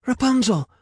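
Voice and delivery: high, loud startled scream. A narrator's reading. Medea finds her sister behind her, screaming. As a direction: shriek the name in shock. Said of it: "she screamed".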